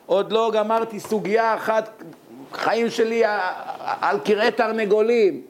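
high-pitched aggrieved outcry, climbing to the end; crying out. Retold or imitated. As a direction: plead your troubles, pitch rising to a cry. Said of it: "אני צועק".

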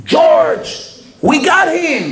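very high excited shout of a name; a sudden high-pitched triumphant call; agent shouting. Retold or imitated. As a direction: yell it out as the agent who caught his man. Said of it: "פתאום ההוא צועק".

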